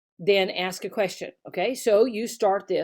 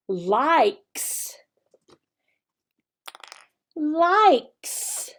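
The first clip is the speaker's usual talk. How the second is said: likes said slowly, second higher; drilled word; 5 semitones higher than usual. Shown as a demonstration. Teacher drilling the word likes for children. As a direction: repeat likes clearly to drill it. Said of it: "the words that we're teaching".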